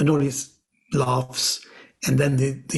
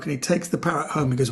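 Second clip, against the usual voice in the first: normal talking voice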